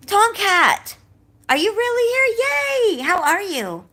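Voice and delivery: gasping, high, loud delighted squeal; excited greeting. Real, not acted. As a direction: stretch out the delighted hello. Greets a friend at door.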